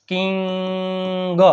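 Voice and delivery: "કિંગ" with a long-held nasal middle; drawn-out sounding out. Shown as a demonstration. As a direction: stretch "કિંગ" very slowly for learners. Teacher sounds out the word stretched.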